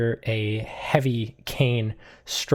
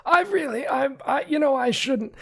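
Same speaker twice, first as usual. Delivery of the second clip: much higher stammering manservant; a stiff butler trying to get out of it; high, halting polite refusal; flustered butler. Acted. Archimedes declines stammering.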